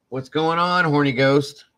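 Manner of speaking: loud, high mock hail; teasing greeting. Greeting Dan with his new nickname, teasing. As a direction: call the nickname out to needle a friend.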